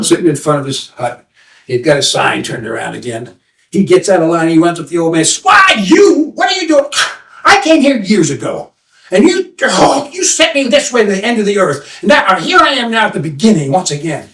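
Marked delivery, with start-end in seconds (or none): 5.4-14.3 put-on indignant young man. Young man accusing the seer of tricking him, high and indignant. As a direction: confront the old man angrily.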